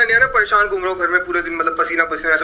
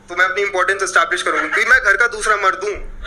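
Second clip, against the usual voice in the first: ordinary delivery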